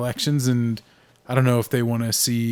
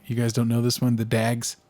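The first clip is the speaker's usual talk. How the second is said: ordinary talk, nothing marked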